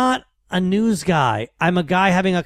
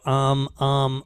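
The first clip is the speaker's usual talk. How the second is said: lower, unhurried filler "um"s, as if thinking; G Gordon Liddy's filler ums; 3 semitones lower, 4 dB quieter than usual. Retold or imitated. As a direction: imitate a talk host's drawn-out hesitation. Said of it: "G Gordon Liddy used to go".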